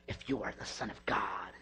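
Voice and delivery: low, whispered devil's voice; whispered Satan tempting; satanic whisper. Acted. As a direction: breathe the temptation in a hiss. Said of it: "That's my satanic voice".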